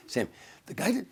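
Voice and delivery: high, breaking up mid-sentence; nervous laughter. Retold or imitated. Trying to explain to an angry Sammy, cracking up nervously. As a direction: fight the giggles and lose, pitch climbing. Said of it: "I break him laughing again".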